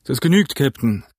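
breezy pirate agreement; cheerful call. A narrator's reading. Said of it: "rief Long John fröhlich".

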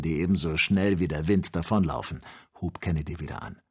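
usual speaking voice

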